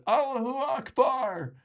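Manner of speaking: high, drawn-out cry; imitated audience call. Audience member after the first rebuttal, imitated high call. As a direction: mimic a long, high chanted call.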